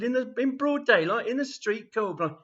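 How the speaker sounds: loud, high indignant tirade; acted scandalised grandad; outraged old man. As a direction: rant at them in public outrage.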